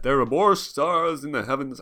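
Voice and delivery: hammed-up American film-narrator voice; grand American-accented narration. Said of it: "I'm not gonna do my American accent".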